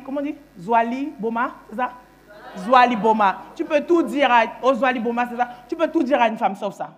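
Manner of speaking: usual speaking voice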